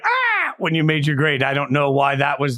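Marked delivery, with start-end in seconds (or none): 0.0-0.5 sudden high-pitched "ah"; imitated startled reaction. Co-host replaying his reaction to the grade, high "ah". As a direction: re-enact an involuntary high yelp. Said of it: "I am curious why I went".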